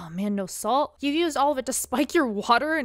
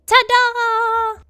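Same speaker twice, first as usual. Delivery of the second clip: high, loud triumphant ta-da; big loud ta-da; 14 semitones higher, 14 dB louder than usual. Acted. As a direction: shout it even bigger. Louder second try for brother.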